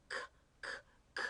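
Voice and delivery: isolated "k" said as a crisp K sound, repeated; "k, k, k" as separate bursts of the K sound; hard C sound. Shown as a demonstration. Teacher showing the hard sound of C, "k" as separate K sounds. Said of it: "The hard sound of C is".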